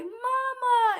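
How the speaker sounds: high, stretched-out cry for mom; hammed-up tearful calling out; dramatic wail. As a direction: wail it like a melodrama reunion. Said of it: "we so dramatic".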